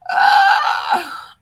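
sharp high yelp of emotion, loud; retold cry. Retelling her reaction when her coach asked about herself, a loud high cry. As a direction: let out a sudden high cry. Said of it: "Oh God, I cried".

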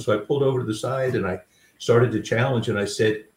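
ordinary talk

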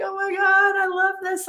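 high-pitched, held-up mock-crying gush; retold tearful joy. Coach retelling her reaction to clients' video, high tearful gush. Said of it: "I started crying, and I was just like".

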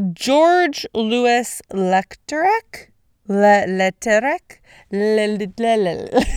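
slow, stuttering attempt at Leclerc; stumbling pronunciation. Shown as a demonstration. Podcaster fumbling the French name, halting. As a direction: drag out and trip over Leclerc. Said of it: "anyways, that's not really important".

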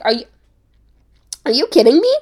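loud, rising are you kidding me; stunned and outraged at the mix-up; disbelief. Retold or imitated. Retelling her shock at the coffee-maker plunger, loud disbelief.

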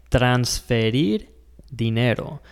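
transferir said slowly, every syllable clear; careful Spanish pronunciation. Shown as a demonstration. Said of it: "make sure that you pronounce it properly".

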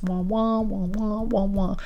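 muted trombone-like wah wah; soft droning wah wah noises; Peanuts adult wah wah. Retold or imitated. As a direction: mimic the muffled Peanuts adults. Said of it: "like the adults talking on Peanuts".

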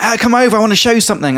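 high-pitched mock holler; imitated calling over. Food seller beckoning a customer over, mock calling out. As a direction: imitate someone calling from down the road. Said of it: "But he was like".

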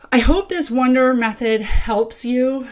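usual speaking voice

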